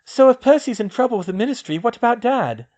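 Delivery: put-on a worried girl asking about her father. Ginny fearing for her dad, worried girl's voice. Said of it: "Ginny said worriedly".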